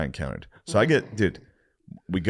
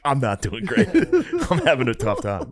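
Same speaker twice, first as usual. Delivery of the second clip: laughing; 5 semitones higher than usual. Retold or imitated. Answering girlfriend, laughing.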